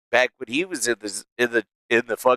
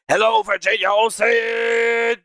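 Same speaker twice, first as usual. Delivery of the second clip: shouted greeting; 7 semitones higher, slower than usual. Real, not acted. Streamer greeting a viewer in chat, drawn-out shout. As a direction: call the name out big.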